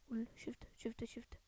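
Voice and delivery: urgent unvoiced whisper. Real, not acted. Quietly telling his teammate he spotted the enemy, whispering. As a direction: hiss it to your teammate.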